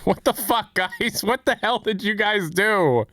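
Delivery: high-pitched incredulous outcry; high, drawn-out bewilderment at the end; real astonished exclamation. Real, not acted. Reacting to community's game changes.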